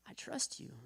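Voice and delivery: a whispered act of trust; whispered prayer. Retold or imitated. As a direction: breathe it out as a prayer. Prayer in hospital room.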